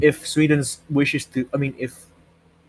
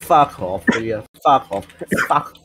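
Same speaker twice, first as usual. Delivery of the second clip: ordinary talk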